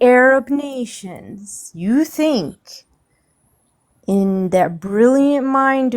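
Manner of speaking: slow, drawn-out sarcastic disbelief. Real, not acted. Panelist mocking another panelist's idea, slow and sarcastic. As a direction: spell it out slowly, dripping with sarcasm. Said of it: "Excuse my sarcasm".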